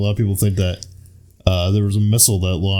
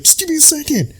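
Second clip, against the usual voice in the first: much higher, pleading put-on voice; Mark Wahlberg impression. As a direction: squeak out the plea. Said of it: "he's just like".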